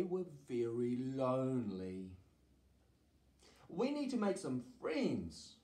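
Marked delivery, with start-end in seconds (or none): 3.7-5.5 higher-pitched dragon voice; high storybook character voice. A narrator's reading. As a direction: read it as lonely dragons, voice lifted. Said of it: "they said".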